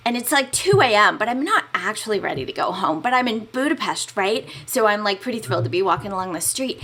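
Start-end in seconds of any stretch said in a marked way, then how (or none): none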